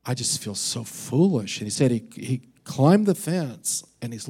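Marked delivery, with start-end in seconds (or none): 0.0-1.6 softer, sheepish mutter; embarrassed murmur. Retold or imitated. Pastor alone in the pasture, softly sheepish. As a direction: mumble it like you feel foolish. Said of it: "It's like he just like".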